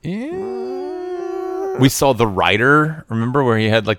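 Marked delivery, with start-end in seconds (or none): 0.0-1.8 high squeaky falsetto. Pretend.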